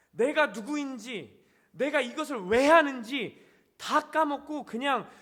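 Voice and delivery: slow, loud emphatic preaching; preaching loudly. Real, not acted. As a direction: drive it home, slow and strong. Preacher building intensity.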